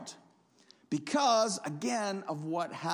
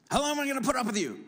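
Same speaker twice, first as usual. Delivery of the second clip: higher, fast exasperated parent snap; fed-up parent; 8 semitones higher, 4 dB louder, much faster than usual. Retold or imitated. A parent fed up with the kids, exasperated. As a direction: blow up like a tired parent. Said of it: "how many of you are parents have ever said".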